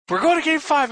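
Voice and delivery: high-pitched acted-out announcement; retold announcement. Viewer quoting the player to his face, high and keyed up. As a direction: imitate the player calling it, pitched right up.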